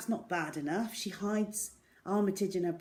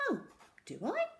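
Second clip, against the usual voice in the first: high, mock-innocent little question; mock innocence; 4 semitones higher, 3 dB quieter, faster than usual. A narrator's reading. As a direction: play dumb, sweetly. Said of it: "mocking innocently".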